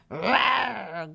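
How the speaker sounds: drawn-out rasping roar; mock dragon growl. A narrator's reading. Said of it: "growled Spud the dragon".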